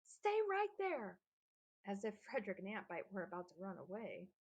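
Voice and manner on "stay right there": slow, high and loud command; shouted order. A narrator's reading. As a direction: call out slowly across the water. Eric keeps shouting to the boys.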